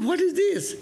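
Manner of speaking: startled question. Retold or imitated. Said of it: "He said".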